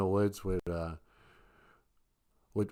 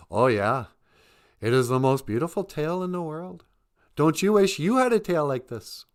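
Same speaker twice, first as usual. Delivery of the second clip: high, loud bragging; boastful beaver; 6 semitones higher, 6 dB louder than usual. Acted. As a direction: puff up and gloat over the tail.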